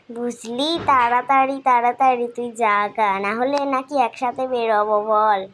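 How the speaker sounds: normal talking voice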